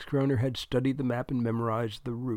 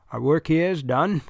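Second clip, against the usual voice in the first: louder, higher New England character voice; New England accent, projected and self-assured; thick New England accent; 4 semitones higher, 6 dB louder than usual. A narrator's reading. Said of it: "in his thick New England accent".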